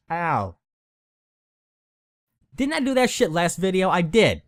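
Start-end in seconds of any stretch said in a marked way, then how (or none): 0.1-0.5 pained little ow; just bit tongue. Real, not acted. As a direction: wince from a bitten tongue. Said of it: "I just bit my tongue".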